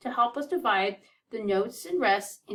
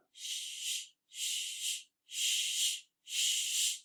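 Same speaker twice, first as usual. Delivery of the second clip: soft unvoiced shh for each rest; shushing rests; 10 dB quieter, much slower than usual. Shown as a demonstration. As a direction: hush each rest out softly. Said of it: "Do you remember how to say 'em?".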